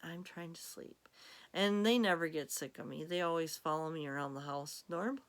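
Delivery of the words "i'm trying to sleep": much softer, breathy whisper as the grumpy cat; whispered annoyed cat voice; sleepy whispered cat. Pretend. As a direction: hush it like a cat who wants to sleep. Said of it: "She's looking at me like".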